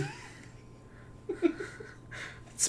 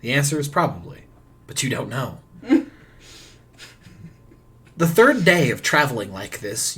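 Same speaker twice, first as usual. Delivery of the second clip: ordinary delivery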